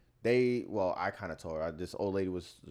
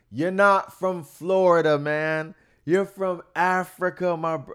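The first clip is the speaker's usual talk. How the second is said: much higher, louder mock hustler, slowed; insistent street seller; 9 semitones higher, 9 dB louder, slower than usual. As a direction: imitate a seller insisting you're African. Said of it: "He's like".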